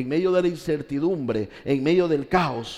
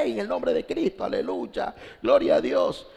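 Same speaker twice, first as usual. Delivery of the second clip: mock revival cry, starting high, no real volume; mock excited shouting; 5 semitones higher than usual. Preacher mimicking emotional event-goers, mock excited shouting. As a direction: imitate an overexcited worshipper without really yelling. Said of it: "grita, salta, patalea".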